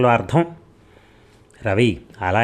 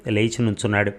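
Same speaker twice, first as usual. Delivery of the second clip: ordinary delivery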